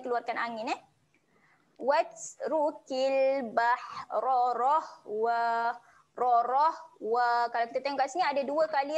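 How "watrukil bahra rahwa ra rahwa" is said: slow recitation with heavy ra; thick ra, drawn slowly; recited; Quran recitation. Shown as a demonstration. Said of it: "baca dengan tebal".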